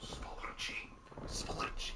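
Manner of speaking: hushed, hissy mock Russian; mock Russian whisper.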